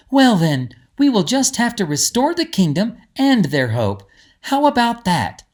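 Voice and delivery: put-on a girl putting on a brave face; Lizzie's brave reply. Lizzie answers Greta bravely.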